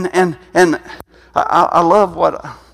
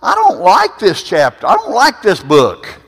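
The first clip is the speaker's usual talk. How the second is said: complaining to God; 7 semitones higher, 4 dB louder than usual. Retold or imitated. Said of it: "I just had to say".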